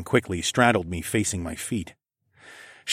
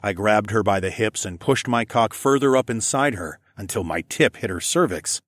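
ordinary delivery, nothing marked

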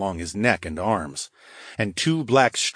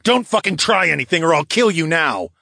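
loud, higher snarling threat; snarled threat; 8 semitones higher, 6 dB louder than usual. Acted. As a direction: snarl it right in his ear. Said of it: "I snarled into his ear".

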